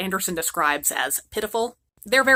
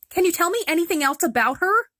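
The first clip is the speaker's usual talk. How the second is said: put-on much higher, eager little girl; a hopeful girl pleading for clues; lightly voiced young girl; eager Gerda; 10 semitones higher than usual. Gerda asks the pigeons.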